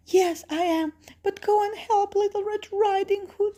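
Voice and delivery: high-pitched granny voice; grandmother. Acted. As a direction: answer high, as an urgent storybook granny. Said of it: "says grandmother".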